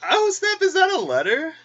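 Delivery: high, loud gasp of surprise at spotting a letter; excited discovery. Retold or imitated. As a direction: squeal it like you just found a treasure. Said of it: "I was like".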